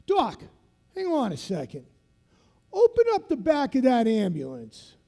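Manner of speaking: hint of the Boston instructor calling the doc; South Boston instructor. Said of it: "he talks like this 'cause he's from South Boston".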